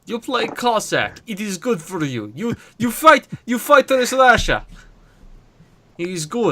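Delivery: put-on playful Russian accent in broken English; hammed-up Russian soldier, proud and blunt; mock Russian accent, playing it up for the stream. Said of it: "he loves your Russian accent".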